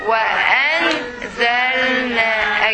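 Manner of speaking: "Wa, an, zal, na" in separate chunks, pausing between; divided into syllables. Shown as a demonstration. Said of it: "Okay, you can divide here".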